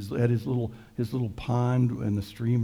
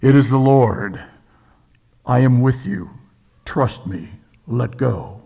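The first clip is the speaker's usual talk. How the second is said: much louder, booming, godlike pronouncement; big voice from heaven; 9 dB louder than usual. Acted. The Lord answering the dangling hiker, booming loud and commanding. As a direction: boom it down like the Lord from the clouds. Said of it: "a big voice came down from heaven".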